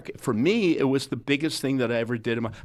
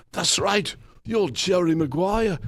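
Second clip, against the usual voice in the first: higher, slower delighted recognition; 7 semitones higher, slower than usual. Retold or imitated. [name] voiced in retold meeting.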